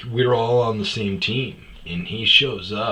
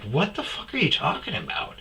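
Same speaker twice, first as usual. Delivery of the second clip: high-pitched, exasperated disbelief; incredulous retort; 8 semitones higher, 4 dB quieter, faster than usual. Retold or imitated. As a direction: throw the question back, baffled. Retold argument, baffled reply.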